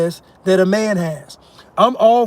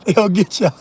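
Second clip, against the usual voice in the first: words breaking into a cackle; laughing; faster than usual. Real, not acted. Teasing listeners about getting caught, laughing. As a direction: say it through real laughter. Laughing at his imagined interview.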